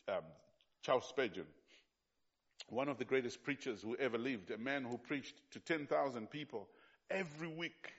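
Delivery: ordinary talk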